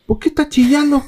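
voz aguda de burla; hermano burlón. Retold or imitated. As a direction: se burla del llanto. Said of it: "llega mi carnal". (el hermano se burla de su llanto, agudo)

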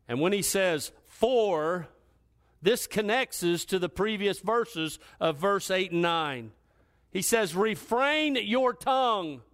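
high, forceful pulpit exposition; impassioned preaching. Real, not acted. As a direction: preach the verse out with fervor. Preacher expounding the verse.

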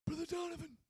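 high-pitched impression of an Italian New Yorker; Paul. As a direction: put on a high mafia-guy voice. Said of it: "this is how he talks".

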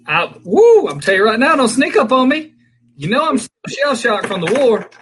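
startled high loud yelp. Real, not acted. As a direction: jump and yell, high and loud. Startled by the prank.

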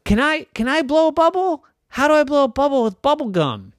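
put-on high, eager little-kid questions; eager child. Child asking Mommy how to blow a bubble, eager. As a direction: ask high and quick, like a curious child. Child asks mother in story.

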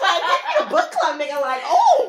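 loud high laughing; cracking up mid-sentence. Real, not acted. Laughing at book club joke.

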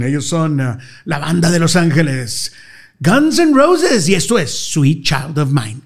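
slow booming radio announcer voice; drawn-out vintage DJ introduction, loud; Al González impression. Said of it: "tratando de imitar a Al González".

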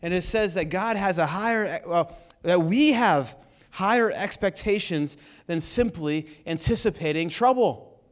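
ordinary delivery

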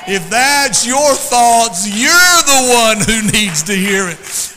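thundering pulpit delivery, words stretched out; full-force preaching. Real, not acted. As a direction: drive it home to the congregation at full volume.

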